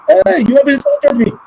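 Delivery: hammed-up indignant shout at the child; offended parent. Offended parent, acted outrage.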